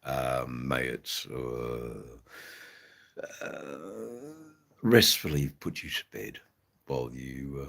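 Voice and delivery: low, soft and slow, lulling; dulcet bedtime tones. Pretend. Streamer offers viewer Maggie his accent as a bedtime lullaby, dulcet tones. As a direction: lull them to sleep with the accent. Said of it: "enjoy the dulcet tones".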